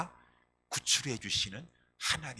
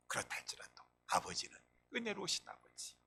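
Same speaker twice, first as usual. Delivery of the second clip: ordinary talk, nothing marked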